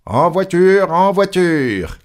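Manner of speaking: high, loud, drawn-out call; conductor's shout. A narrator's reading. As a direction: call passengers aboard, stretching the words. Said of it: "cria le conducteur".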